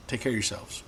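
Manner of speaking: ordinary delivery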